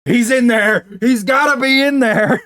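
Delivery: high, loud hammed-up insistence; mock insistent shout. Host insists the real guy is in there, high mock shout. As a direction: yell like a detective on the hunt.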